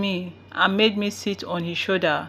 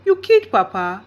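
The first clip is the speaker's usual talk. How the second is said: same words, now louder and sharper; louder disbelief; 2 semitones higher, 7 dB louder than usual. A narrator's reading. Father realising his mother killed Papa, louder. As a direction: let the shock break through. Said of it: "Dad asked".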